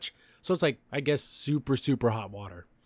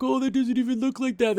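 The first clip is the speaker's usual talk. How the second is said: high-pitched teasing imitation; mock teasing; 9 semitones higher than usual. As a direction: make fun of her guess in a raised voice. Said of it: "They're kinda making fun of her".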